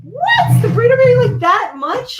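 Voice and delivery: high, loud shocked What; can't believe what she just heard; shocked disbelief. Real, not acted. Disbelief at beer ranking.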